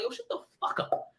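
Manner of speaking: whispered inner complaint; in my mind. Retold or imitated. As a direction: hiss it to yourself in the dark. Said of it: "in my mind, I'm like".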